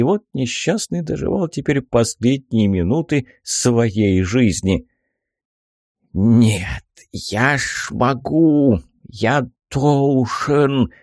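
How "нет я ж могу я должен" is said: slow, feeble toothless mumbling; dying old man straining, mumbled; toothless old man mumble. Acted. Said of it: "прошамкал беззубым ртом".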